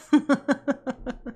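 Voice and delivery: cracking up at her own joke; helpless giggling laugh; laughing at herself; real laughing fit. Real, not acted. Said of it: "I cracked myself up".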